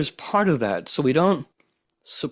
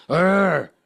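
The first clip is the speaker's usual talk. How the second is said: a raised, louder growling "Arr"; mock growl; 5 semitones higher, 4 dB louder than usual. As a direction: play the brain roaring for a fight. Brain's war cry.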